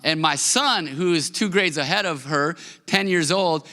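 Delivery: usual speaking voice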